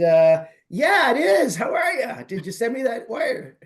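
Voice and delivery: high, loud, jokey playing along; mock-hearty phone greeting; hammed-up enthusiasm. Jokingly playing along as wrong Alex.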